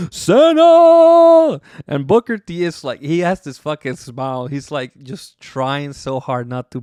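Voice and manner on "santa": much higher, much louder drawn-out cry; hammed-up howl of grief; mock anguished scream. Cena screaming for Santa, hammed-up anguished howl. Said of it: "Cena is like".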